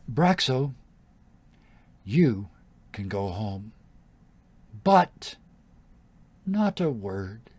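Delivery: loud, slow warning with pauses; menacing dismissal. Acted. Queen releases Braxo with a threat, menacing.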